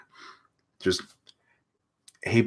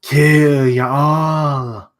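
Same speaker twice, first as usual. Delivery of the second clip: slow, louder, drawn-out bad German accent; shitty German accent; 3 semitones higher, 7 dB louder, much slower than usual. German villain threatening everyone, louder in a bad German accent. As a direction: roar it slowly as a mock German villain. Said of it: "give him a shitty German accent".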